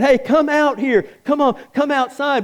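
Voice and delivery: high, coaxing call out; mock king calling. King calls preacher out of the furnace, coaxing call. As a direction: beckon someone out like a king. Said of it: "if he said".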